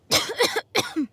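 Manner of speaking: strained cough from a weakened body; harsh sick cough; pained cough. Acted. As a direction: cough as the effort catches you. Weakened knight strains herself.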